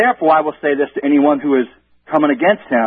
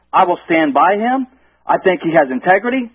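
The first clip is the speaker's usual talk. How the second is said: ordinary talk, nothing marked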